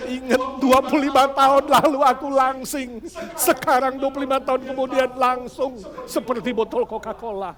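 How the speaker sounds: mock-rueful about her figure; mother's lament. Mother mock-complaining about her figure to Betty, lightly voiced. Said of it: "Mamanya bilang".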